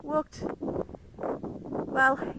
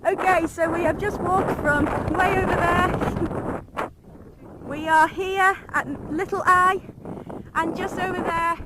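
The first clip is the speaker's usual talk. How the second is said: shouting over wind; 6 semitones higher, 8 dB louder than usual. Real, not acted. Outdoors on windy sand flats.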